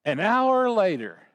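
ordinary delivery

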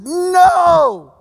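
a loud high shout of correction; ringing classroom shout. Retold or imitated. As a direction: yell it to shake the class. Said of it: "He's like".

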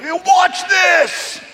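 high, cocky creature line; cocky scorpion. Retold or imitated. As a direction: sneer it as the scorpion. Scorpion voiced in story.

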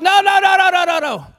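loud, high repeated no; God firmly refusing; emphatic no. Acted. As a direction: shake the head with each no. God refusing the rich man.

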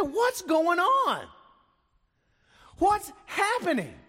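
high, strained call over a crowd; a bystander calling out, high and urgent. Acted. Crowd member shouting question.

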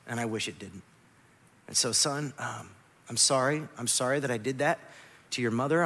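low, soft, halting confession; humble apology. Retold or imitated. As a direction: apologise gently to a small son. Retells humble apology to young son.

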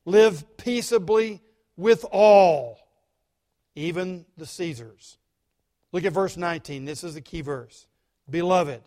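usual speaking voice

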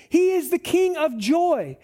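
ordinary delivery

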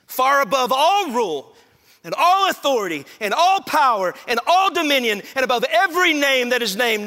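loud, high preaching crescendo; full-force scripture proclamation. Acted. Preacher reads Paul to the congregation, fervent and forceful. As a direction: build to a peak, then ease down at the end.

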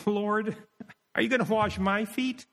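high-pitched astonished question, rising at the end; incredulous question. Shown as a demonstration. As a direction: ask in high, acted disbelief. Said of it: "Or he could have said it".

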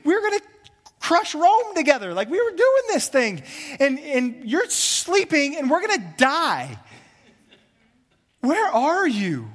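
high, loud pleading panic to a sleeping Jesus; frantic disciples. Retold or imitated. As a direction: beg like scared friends in the storm. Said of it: "They had the same question".

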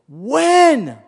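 When cried out high and loud; preacher erupting on one word; sudden shout. Acted. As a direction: burst out on when.

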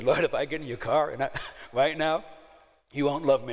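loud and high, laughing through the warning; half-laugh a warning before being sick; laughing drunk plea. Retold or imitated. Drunk son-in-law warning father-in-law, loud and laughing.

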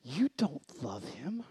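low, drawn-out incredulous scolding; slow disbelieving rebuke. Retold or imitated. Dad starting to lecture lovesick daughter, slow incredulous rebuke. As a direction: stretch each word in mock disdain. Dad's imagined lecture begins.

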